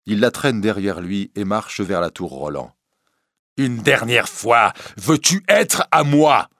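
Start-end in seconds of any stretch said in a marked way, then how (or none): 3.6-6.5 loud, slow, rising in intensity; final ultimatum. Acted. Priest dragging the girl, demanding fiercely. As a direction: demand it slowly and forcefully.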